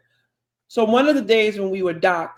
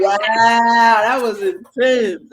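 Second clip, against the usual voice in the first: slow, stretched-out wow, louder; amazed; 7 dB louder, much slower than usual. Real, not acted. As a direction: marvel at it, dragging the words. Reacting to fist bump chant.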